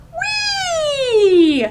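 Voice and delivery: very high, squealing frog "Wee"; frog's happy cry. Acted. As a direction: let the frog squeal with joy.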